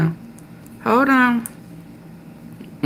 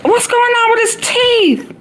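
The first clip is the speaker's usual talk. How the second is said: very high squeaky what-the, fast at the end; high-pitched shocked rambling; stunned disbelief. Retold or imitated. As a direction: squeal in outrage and confusion. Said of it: "I said".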